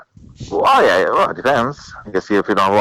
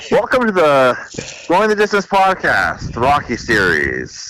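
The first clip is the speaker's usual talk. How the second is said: announcer voice; 3 semitones higher, slower than usual. Acted. Podcast intro in announcer voice.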